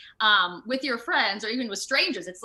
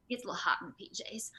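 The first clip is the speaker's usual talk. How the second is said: whispered aside; 2 semitones higher, 11 dB quieter, faster than usual. Real, not acted. Actress whispering an aside about the hot suit. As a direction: let it slip out as a secret aside.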